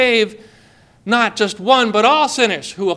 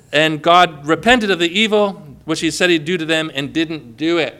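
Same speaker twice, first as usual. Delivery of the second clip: ordinary talk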